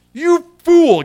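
"You fool" shouted high and hard, no sugarcoating; strong rebuke. Acted. Preacher repeating God's words to the rich man, loud high rebuke. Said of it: "There's no way to sugarcoat that".

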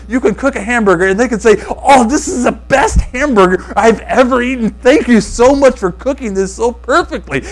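high-pitched, gushing mock delight; giddy imitation. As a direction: gush like a kid at Christmas, grinning. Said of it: "you see how I am right now?".

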